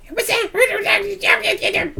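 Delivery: very high-pitched kobold squawk; draconic voice. Acted. Kobold spy reporting to his leader, draconic voice. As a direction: squeak it out as an excitable little dragon-creature. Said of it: "in, like, some draconic voice".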